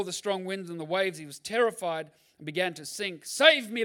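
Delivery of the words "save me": louder, higher cry for help; shout. A narrator's reading. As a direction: Peter sinking and calling to Jesus, mild shout. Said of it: "he shouted".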